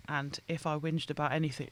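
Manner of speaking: normal talking voice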